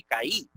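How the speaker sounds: caí as ka-Í, stress on the final i; mispronounced kai. Retold or imitated. Teacher imitating people's wrong reading, ka-Í. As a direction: split caí into two syllables, landing on Í. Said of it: "gente pronunciándolo así".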